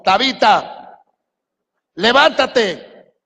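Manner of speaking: slow, drawn-out commanding call. Shown as a demonstration. Peter ordering dead Tabita to rise, slow drawn-out call. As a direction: stretch out the command to raise her. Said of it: "Yo estoy gritando aquí".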